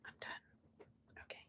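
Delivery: whispered I'm done. Real, not acted. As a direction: fade to a whisper. Trailing off after joke.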